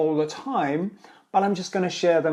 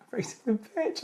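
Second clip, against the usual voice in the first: "raising the pitch" said much higher and softer, laughing; raised pitch; 8 semitones higher, 8 dB quieter than usual. Shown as a demonstration. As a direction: lift "raising the pitch" into a high squeaky demo. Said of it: "rather than raising your voice or, uh, the pitch".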